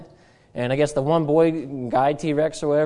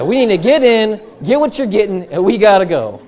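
louder, hurried husband urging his wife along; mock urgent husband; 4 semitones higher, 6 dB louder than usual. Husband in a store urging his wife to hurry, louder and pushy. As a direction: get in and get out, press her to move. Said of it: "He said".

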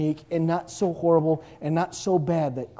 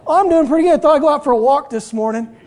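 higher, louder, breezy answer; healed man replying; 9 semitones higher, 10 dB louder than usual. Acted. Healed paralytic telling the blind men about his walk, high and breezy. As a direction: tell them you're out for a stroll.

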